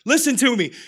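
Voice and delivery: normal talking voice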